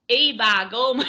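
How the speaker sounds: loud, broad Yorkshire exclamation; broad Yorkshire accent. Shown as a demonstration. Demonstrating Yorkshire to the host, broad Yorkshire accent. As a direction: belt it out in proper broad Yorkshire. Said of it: "you go to Yorkshire".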